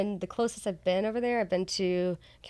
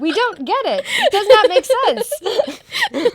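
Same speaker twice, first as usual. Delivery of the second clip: laughing disbelief; 12 semitones higher, 12 dB louder, much slower than usual. Retold or imitated. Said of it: "Be like".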